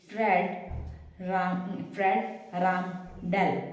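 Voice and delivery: Fred राम डेल drawn out slowly; teacher sounds out Fred राम डेल syllable by syllable; slow name demo. Shown as a demonstration. Teacher shows students how to say the name, slow. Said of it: "डेल का computer सुना है".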